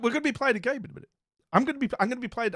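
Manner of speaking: fast, high hype; excited. Real, not acted. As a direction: rush it out, buzzing. Excited game announcement.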